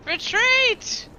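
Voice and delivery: high yelled battle cry; shouted retreat. Pretend. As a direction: yell it like fleeing combat. Player imagines shouting in combat.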